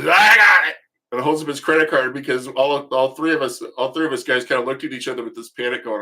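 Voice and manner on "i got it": put-on loud high drunken yell; drunk shout. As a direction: bellow it like a blitzed man grabbing the bill. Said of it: "Stuart just goes".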